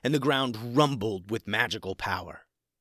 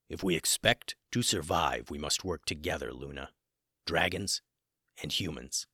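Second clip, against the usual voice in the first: much lower, quiet solemn dragon; 6 semitones lower, 5 dB quieter than usual. Acted. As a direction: speak low and grave, like a wise dragon. Gray Dragon's solemn words.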